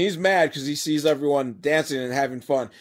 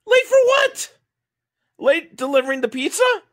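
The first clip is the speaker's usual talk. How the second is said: loud, very high-pitched disbelief; baffled comic outburst; incredulous; 5 dB louder than usual. Real, not acted. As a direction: challenge the nonsense in exasperation. Incredulous question about the plot.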